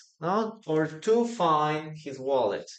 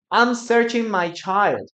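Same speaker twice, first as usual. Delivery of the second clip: wrong example; 6 semitones higher, 7 dB louder than usual. Shown as a demonstration. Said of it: "No, we never say".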